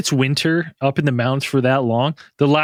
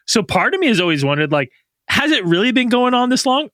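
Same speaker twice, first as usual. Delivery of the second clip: ordinary delivery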